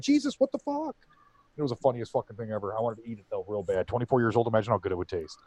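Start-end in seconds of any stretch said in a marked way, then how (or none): 0.0-0.9 very high, softer startled jesus; startled cry. Retold or imitated. Coworker startled by the parrot, very high yelp. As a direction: yelp it in fright.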